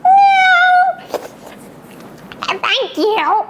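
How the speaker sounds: a squeaky kitten saying thanks; cartoon kitten voice; grateful cat. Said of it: "And Stripes went".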